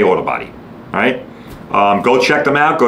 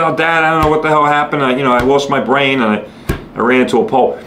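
put-on upset kid. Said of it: "My kids all upset".

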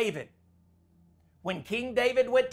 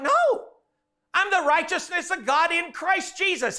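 indignant rebuttal; 8 semitones higher, 4 dB louder than usual. Retold or imitated. Retold argument, preacher rebutting.

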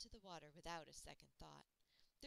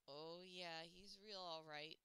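plain reading voice

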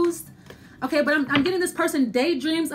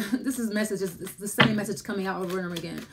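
muttered aside; 7 semitones lower, 5 dB quieter, faster than usual. Real, not acted. Aside about repeating tarot message.

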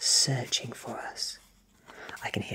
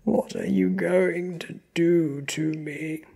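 high, loud, drawn-out fearful plea; terrified victim, voice raised; very afraid; 8 semitones higher, 7 dB louder than usual. A narrator's reading. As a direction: cry out slowly in dread. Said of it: "He is very afraid".

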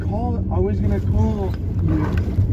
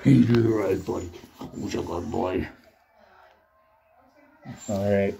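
usual speaking voice

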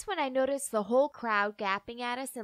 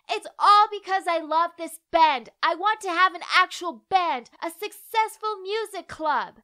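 loud, high furious outburst; screaming; 5 semitones higher, 5 dB louder than usual. Acted. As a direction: let it all out, shouting. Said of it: "screamed at them".

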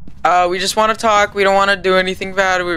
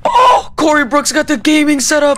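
excited; 10 semitones higher, 3 dB louder than usual. Real, not acted. Excited discovery, high and loud.

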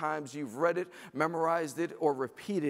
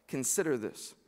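usual speaking voice

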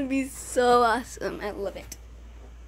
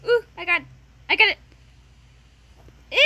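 ordinary delivery

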